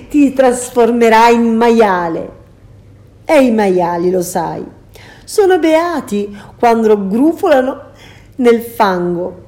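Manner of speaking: loud, cruel witch gloat; gloating threat. Acted. Circe gloating that Ulysses will become a pig, loud. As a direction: savour the threat. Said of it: "la risata cattiva".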